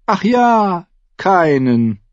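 high, drawn 'oh yes', dropping away; feigned innocence. A narrator's reading. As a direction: needle him with mock innocence. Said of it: "Renly lächelte unschuldig". (gespielt unschuldig, mit hoher Stimme)

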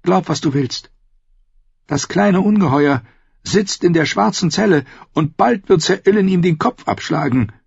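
high woman's voice, cold spite; vindictive sister. A narrator's reading. Sister foretells the dwarf's beheading, vindictive. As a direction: gloat over the coming execution in a high female voice.

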